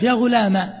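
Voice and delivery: غلام ending in a short a, no long vowel; ghulama short a. Shown as a demonstration. Teacher demonstrating the sixth form, short final a. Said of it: "الفتحة بدون ألف".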